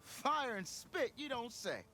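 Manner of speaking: high, slow amazed exclamation. Acted. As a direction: gasp it out in disbelief. Surprised the tunnel is cleared.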